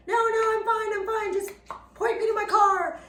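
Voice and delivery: high, loud mock protest that she's fine. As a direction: squeak out that you're fine, waving off help. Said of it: "I'm like".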